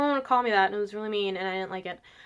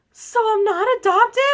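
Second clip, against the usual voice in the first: high, loud hopeful question; hammed-up upset kid seeking confirmation; mock tearful disbelief; 10 semitones higher, 6 dB louder than usual. Bawling girl asking her laughing mom, tearful disbelief. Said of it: "like, crying".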